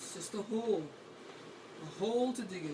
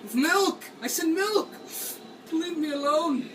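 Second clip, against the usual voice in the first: high, loud yell of milk; frantic shout; 6 semitones higher, 5 dB louder than usual. Real, not acted. Scared player yells his comfort demand, high and loud. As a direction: insist on it, shouting.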